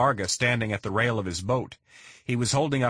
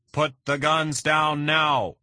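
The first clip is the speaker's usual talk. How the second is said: slow, very high, loud command; megaphone yell; 7 semitones higher, 4 dB louder, slower than usual. A narrator's reading. Leon orders the kidnappers from the boat, slow megaphone yell. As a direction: bellow it through a megaphone from the boat.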